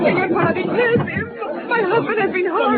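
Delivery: high, frantic cry for help; panicked plea. Acted. Wife begs Paladin to help her wounded husband, panicked. Wife cries out after attack.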